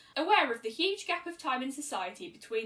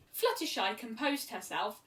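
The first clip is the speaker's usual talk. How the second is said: ordinary delivery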